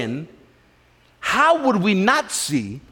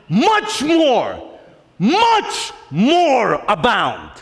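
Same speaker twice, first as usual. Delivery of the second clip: higher, slow, stretched much more abound; drawn out, triumphant much more; proclaimed slowly; 3 semitones higher, much slower than usual. Real, not acted. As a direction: preach it slow and big. Preacher proclaims the verse.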